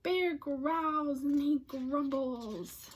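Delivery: ordinary delivery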